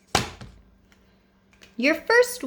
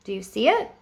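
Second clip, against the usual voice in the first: usual speaking voice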